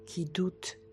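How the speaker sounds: slow, soft relaxation voice with long pauses; soothing sophrology session delivery, softly paced; guided meditation. Acted. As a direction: guide a listener gently through calm breathing, unhurried. Leads a breathing relaxation exercise.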